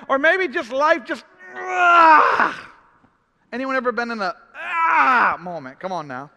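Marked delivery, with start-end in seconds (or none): 1.5-2.7 high, loud exasperated yell; sudden frustrated "ah" yell; frustrated cry. Retold or imitated. Acting out life going wrong. 4.6-5.4 frustrated cry. Retold or imitated.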